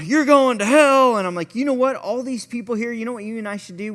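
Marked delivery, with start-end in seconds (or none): 0.1-1.2 loud, high, drawn-out street-preacher yell; yelled condemnation. Retold or imitated. Man with megaphone on a box yelling at people, yelling. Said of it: "He's yelling". 1.5-3.9 higher-pitched, lightly hammed-up eagerness; retold keen suggestion, voice lifted high; eager pitch. Retelling his own eager pitch.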